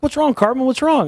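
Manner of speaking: a hint of worried cartoon kids; kids asking Cartman. Kids ask crying Cartman what's wrong, lightly voiced. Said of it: "And they're like".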